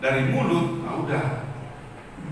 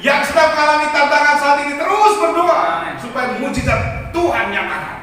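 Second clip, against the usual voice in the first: higher and louder, urging the church on; rousing preacher call; 7 semitones higher, 8 dB louder than usual. Real, not acted. Preacher urging the congregation to keep praying, loud and rousing. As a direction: lift the whole room with this call.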